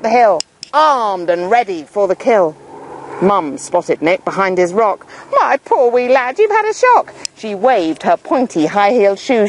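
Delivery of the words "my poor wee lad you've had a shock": higher, cooing mother; fussing mum. Acted. Mum fussing over her son, cooing. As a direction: coo over the frightened boy.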